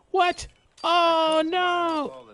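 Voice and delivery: high, loud shock; startled yell. Real, not acted. Player reacting to a sudden mishap in the game, startled yell. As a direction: cry out in alarm.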